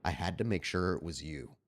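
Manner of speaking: a hint of a deep mysterious stranger. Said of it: "that deep voice that greets us".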